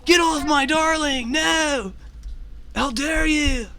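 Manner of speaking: high, loud melodramatic shouting; hammy hero in shrieking outrage; outraged cry. Acted. Companion confronts whoever grapples his darling, indignant high shout. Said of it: "of course, he's like".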